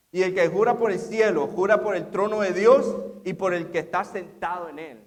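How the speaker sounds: loud preaching read; preached scripture. Acted. Preacher reading Jesus' words to the congregation, loud. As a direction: declaim the verses with force.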